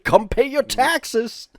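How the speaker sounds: high, drawn-out mock call; cartoon bear tax collector. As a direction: call out like a cartoon sheriff demanding taxes.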